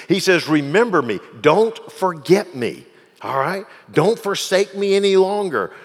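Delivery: loud, rising sermon voice; fiery pulpit preaching; preaching at full force. Acted. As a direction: preach it, building the fire.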